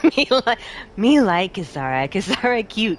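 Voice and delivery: a hint of Wallace, simple broken words; dopey cheese-lover talk, barely put on; lightly voiced Wallace. Streamer joking about the chat, lightly playing Wallace. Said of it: "something that actually Wallace would say".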